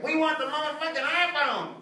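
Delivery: lightly voiced bratty kids demanding; yelling kids. Kids yell at church lady for iPhone. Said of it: "they're yelling at this poor church lady".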